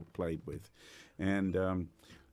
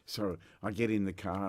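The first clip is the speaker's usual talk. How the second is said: normal talking voice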